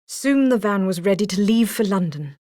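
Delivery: ordinary delivery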